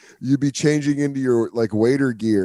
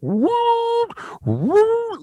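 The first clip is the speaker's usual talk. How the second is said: very high, held mock siren whoop; submarine alarm. Everyone bailing from the locker room, imitated alarm siren. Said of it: "like a submarine alarm went off".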